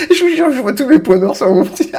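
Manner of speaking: high, loud words through laughter; laughing hard. Real, not acted. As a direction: squeal it out while cracking up. Cracking up over zoomed blackheads.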